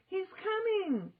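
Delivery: high shout of warning. A narrator's reading. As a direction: yell it in panic. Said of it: "shouted Annie".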